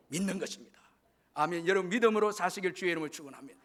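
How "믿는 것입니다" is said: ordinary delivery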